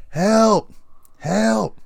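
calling for help. Retold or imitated. Calling up from the well.

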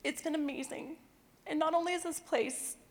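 choked up, high and soft; emotional. Real, not acted. Apologising to the audience for getting emotional, choked up. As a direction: fight back real tears while speaking. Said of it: "why I'm emotional".

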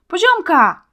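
a high, loud, desperate call of Poziomka; shout. A narrator's reading. Boy calls his bewitched friend from the thicket, desperate loud shout. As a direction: scream her name, pushing through the bushes. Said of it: "krzyknął Marcin".

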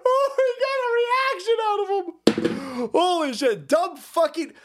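can't believe he bit; excited. Real, not acted. Reacting to clip.

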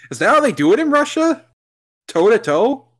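high, fast incredulous question; mock shocked. Real, not acted. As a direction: squeal it in disbelief. Mocking surprise at the fight.

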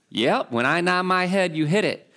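Southern dad, sure of himself; southern accent. Retold or imitated. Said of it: "kind of with a southern accent".

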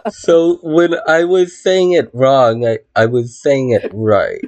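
ordinary delivery

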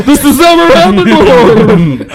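high loud squeal; mock panicked doctor. Friend joins the doctor impression, high and loud. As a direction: chime in as the flustered doctor.

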